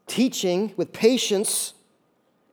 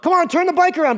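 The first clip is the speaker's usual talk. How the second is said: higher, louder acted impatience; impatient urging; 10 semitones higher, 6 dB louder than usual. Preacher voices people wanting a quick turn, impatient urging. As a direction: urge it on, pitched up and loud.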